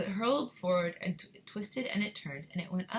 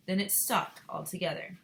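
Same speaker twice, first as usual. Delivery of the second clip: ordinary delivery, nothing marked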